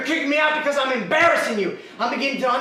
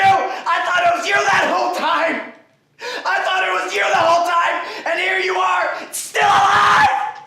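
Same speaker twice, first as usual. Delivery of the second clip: loud, high, dragged-out yells; furious shouting; 5 semitones higher, 3 dB louder than usual. Acted. Son yells at the father who threw him out, furious. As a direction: rage at him for still being alive.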